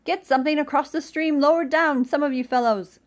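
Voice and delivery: loud urgent call. A narrator's reading. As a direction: call to the others. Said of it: "called Rabbit".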